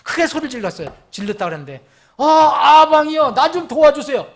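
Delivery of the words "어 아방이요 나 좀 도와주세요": high, loud, drawn-out cry for rescue; shouted plea for help. Acted. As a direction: shout for help to a king far away. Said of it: "이렇게 소리 질렀을까요?". (크고 높게 외치는 구조 요청)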